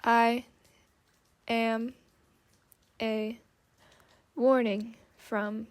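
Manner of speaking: halting, grinding mechanical voice; machine voice. Acted. Automaton repeats its message, halting and mechanical. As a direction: clip each word like clicking gears. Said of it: "The creature whirred and clicked".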